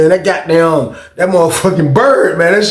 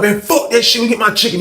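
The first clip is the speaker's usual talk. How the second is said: higher, defiant retort; acted blacking out; 8 semitones higher than usual. Inmate still demanding chicken, acted angry defiance. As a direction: imitate a stubborn angry inmate. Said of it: "He's still blacking out".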